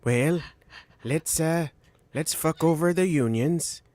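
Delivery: slow, halting impression of a politician; Ronnie. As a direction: do a slow presidential parody, pausing between phrases. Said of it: "my Ronnie impression".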